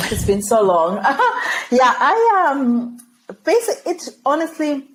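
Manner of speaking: laughing through the words, voice jumping up; breaking into real laughter while starting the answer. Real, not acted. Guest asked why she left Zimbabwe, laughing.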